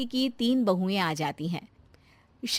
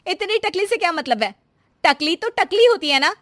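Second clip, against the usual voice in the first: higher, louder, fast offended question; offended argument; 8 semitones higher, 7 dB louder than usual. Acted. Shanti arguing with the doctor, loud and offended. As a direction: push back hard, offended.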